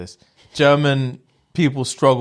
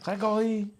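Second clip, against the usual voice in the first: put-on high, softer French-accented call; French girlfriend calling; 9 semitones higher, 9 dB quieter than usual. Uncle's French girlfriend calling his name, soft high French accent. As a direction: sing out the name softly, French-style. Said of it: "And she'd just be there".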